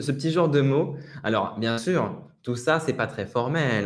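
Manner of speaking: ordinary talk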